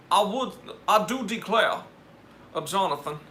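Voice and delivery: mock Southern gentleman declaring. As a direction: play the proper Southern gentleman, mock-genteel. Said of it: "my impression of a proper Southern gentleman".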